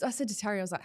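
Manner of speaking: ordinary delivery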